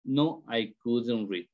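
slow model answers, each falling at the end; short-answer demo. Shown as a demonstration. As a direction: read out both answers slowly and deliberately. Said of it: "And short answer".

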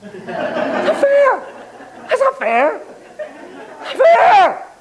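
put-on high, whining child's protest; high kid's wail of not fair; whiny kid. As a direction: whine it like a wronged kid. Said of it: "kids either thinking or saying".

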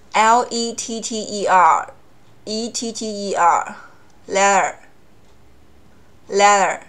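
slow flap-t demo; flapped t. Shown as a demonstration. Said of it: "有点稍微的浊音".

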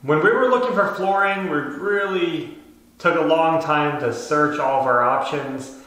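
ordinary talk

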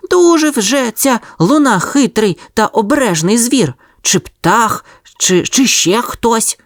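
little dog thinking. A narrator's reading. Sonya the dog puzzles over the moon, high puppy voice. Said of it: "думала Соня".

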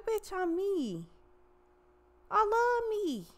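high, loud boastful brag; cocky self-love voice. Retold or imitated. Tarot reader voicing a smug rival, high and boastful. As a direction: puff up and sing your own praises.

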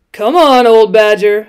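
calling out loud to the badger; shout. A narrator's reading. Rat shouting to the badger in the hedge, loud call. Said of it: "shouted the rat".

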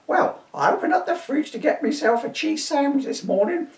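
put-on high squeaky old woman; old lady telling the constable her troubles; crone voice. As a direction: play the fussy old dear. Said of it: "my crone voice".

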